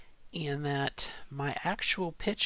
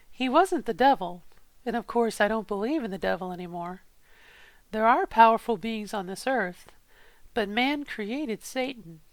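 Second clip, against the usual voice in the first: a first-person tale read aloud with feeling, a little louder; dramatic story reading; 5 dB louder than usual. Acted. Reading a passage aloud into the pitch app, story narration. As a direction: tell it like a confession of a dark deal.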